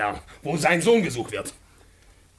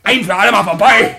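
loud, higher, final; furious shout; 7 semitones higher, 11 dB louder than usual. Acted. Party man shouting down the landlord, furious. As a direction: slam it down like a verdict.

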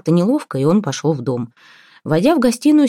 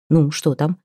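ordinary delivery

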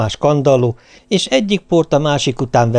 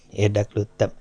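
plain reading voice

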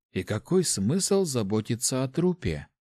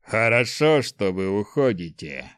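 growling. A narrator's reading. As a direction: growl it like a beast. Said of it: "прорычал владелец дома". (рычание зверя)

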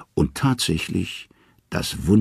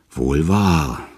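grumbling. A narrator's reading. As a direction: mutter it through sore lips. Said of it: "brummt Loki".

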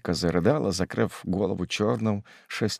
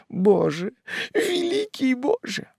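high-pitched wailing plea to God; thin, keening lament; anguished moan. Acted. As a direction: cry out high in desperate grief. Mother falls to knees groaning.

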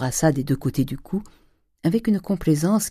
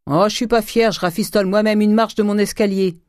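high, raised voice, calling from afar; hearty old master; 7 semitones higher, 4 dB louder than usual. A narrator's reading. Master mending his stairs explains to guests, calling out. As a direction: keep calling out, unashamed of the chore.

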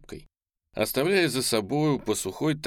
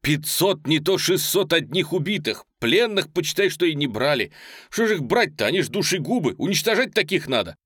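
high, loud, heated telling; fired-up, raised-pitch account; breathless report; 7 semitones higher, 5 dB louder than usual. A narrator's reading. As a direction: pour out battle news excitedly. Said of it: "задыхаясь, говорил он".

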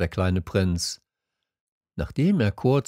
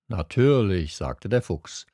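ordinary delivery

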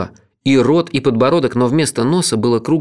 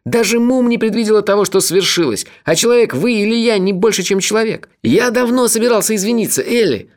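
much higher, rushed earnest pleading; agitated; 10 semitones higher than usual. A narrator's reading. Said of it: "сказал он, волнуясь".

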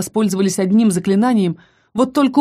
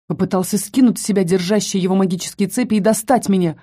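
plain reading voice

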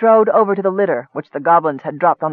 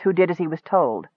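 usual speaking voice

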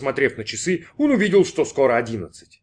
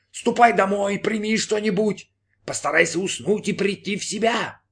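high, coaxing voice in the head; insistent inner voice; 8 semitones higher than usual. Acted. Inner voice sends Brin to bed, high and insistent. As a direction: urge rest in a high, even voice.

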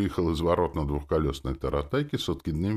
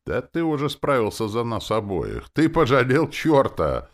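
high, loud gleeful tease; merry; 9 semitones higher, 6 dB louder than usual. A narrator's reading. Demoness gloating that man pitied a devil, merry. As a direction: crow it cheerfully. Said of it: "заявила она весело".

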